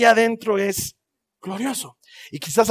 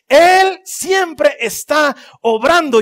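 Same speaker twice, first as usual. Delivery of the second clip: higher, louder, very slow climax; building proclamation; 7 semitones higher, 7 dB louder, much slower than usual. Acted. Preacher proclaiming to the congregation at full force. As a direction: proclaim it at the peak. Repeated sermon refrain building.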